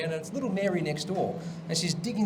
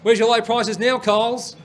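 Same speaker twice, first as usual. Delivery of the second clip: louder, mock-angry protest cry; mock protest shout; 4 semitones higher, 6 dB louder, slower than usual. Host mock-protesting Coles' prices, louder mock yell. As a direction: heckle the supermarket like a protester. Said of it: "It's time for my protest".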